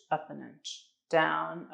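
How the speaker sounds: usual speaking voice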